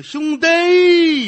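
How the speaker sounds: high, loud crying call; crying wail. Acted. Old man begging his benefactor, loud crying wail. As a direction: wail it like a sobbing old beggar. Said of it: "别兄弟。".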